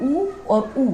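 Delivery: ऊ said twice with lips pushed round; rounded vowel demo. Shown as a demonstration. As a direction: hold ऊ with clearly rounded lips. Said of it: "दोनों होंठों की आकृति हमारी बदल रही है".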